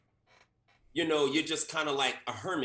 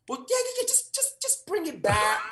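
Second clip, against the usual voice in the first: casting director; 13 semitones higher than usual. Retold or imitated. Casting director asks less.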